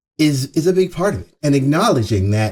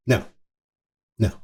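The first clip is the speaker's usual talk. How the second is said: usual speaking voice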